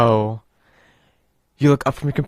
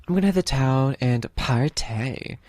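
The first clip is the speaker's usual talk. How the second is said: drawn out and playful, slowly; slow teasing read; slower than usual. Acted. Streamer reading a cheeky dialogue option, slow and playful. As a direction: savour the party line.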